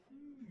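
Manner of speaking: high, soft pondering hmm; a gnome's quiet high hmm; thoughtful hum. Acted. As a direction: hum like a curious healer.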